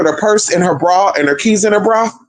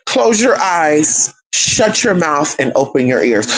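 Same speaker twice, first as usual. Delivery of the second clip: drawn-out, measured instruction; slow, deliberate advice; 2 semitones lower, slower than usual. Real, not acted. Advising listeners on reaching ancestors, slow and deliberate. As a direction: space out each command, taking your time. Repeats advice slowly for emphasis.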